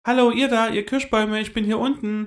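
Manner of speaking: high, loud call up to the treetops; a small squirrel yelling for attention; shouting as loud as she can. A narrator's reading. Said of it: "schrie Hana, so laut sie konnte". (Eichhörnchen ruft die Kirschbäume, schreit so laut es kann)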